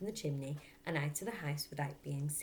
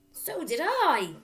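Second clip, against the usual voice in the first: nervous agreement; 11 semitones higher, 11 dB louder, slower than usual. Acted. Brother scared agreeing.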